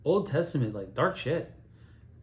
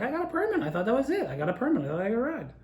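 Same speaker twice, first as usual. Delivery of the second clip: high pleading voice; 10 semitones higher, faster than usual. Retold or imitated. Pleading with angry father.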